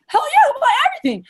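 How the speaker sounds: very high, hammed-up enthusiasm; fired-up customer. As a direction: imitate a customer swept away with excitement. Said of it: "they're so fired up".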